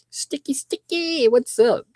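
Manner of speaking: high-pitched playful name call; playful shout-out. Real, not acted. Host hailing a viewer who gifted, playful shout-out. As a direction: greet them in a high, playful voice.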